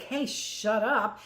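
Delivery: slow, mock-childish "shut up"; mock quarrel. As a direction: drag it out like a squabbling kid. Acted quarrel between two people.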